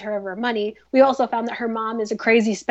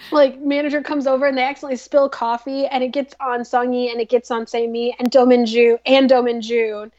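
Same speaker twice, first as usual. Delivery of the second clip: ordinary delivery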